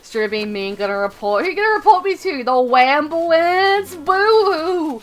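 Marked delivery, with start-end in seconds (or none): 1.4-5.0 high, loud jeer drawn out into a fake wail; mocking taunt. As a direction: taunt them like a crybaby. Mocks a threat to report.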